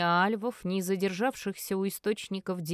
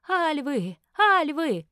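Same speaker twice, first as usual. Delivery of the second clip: shrill panicked shrieks, building louder; frightened shrieking; 7 semitones higher, 6 dB louder, slower than usual. A narrator's reading. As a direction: scream the alarm, each time louder. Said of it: "верещали девицы на улице".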